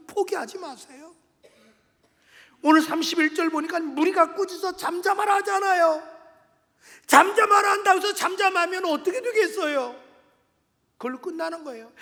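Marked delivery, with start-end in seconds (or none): 2.6-10.1 loud, higher pulpit shout; impassioned, insistent preacher; fervent preaching. Real, not acted. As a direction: challenge the congregation at full voice. Preaches at full force.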